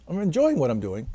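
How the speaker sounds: normal talking voice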